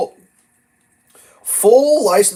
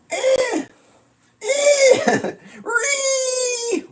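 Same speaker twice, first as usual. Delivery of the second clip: high, screeching mock tantrum noise; much slower than usual. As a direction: squeal like a raging idiot again. Repeats mock idiot screech.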